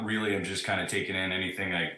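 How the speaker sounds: normal talking voice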